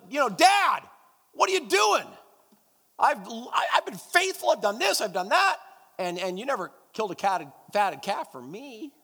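elder son shouting his grievance at his father, aggrieved and loud; indignant complaint. Retold or imitated. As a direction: protest the unfairness at full volume. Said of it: "was so ticked off".

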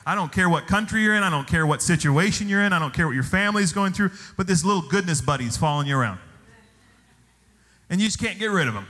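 ordinary talk

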